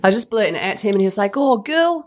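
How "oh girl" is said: high Kiwi twang imitating husband; mock New Zealand accent, high. Wife imitating her Kiwi husband, New Zealand accent. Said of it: "'cause he's a Kiwi".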